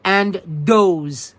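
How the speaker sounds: Brooklynese dem dees does with hard d; New York Brooklyn accent. Shown as a demonstration. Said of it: "the language known as Brooklynese".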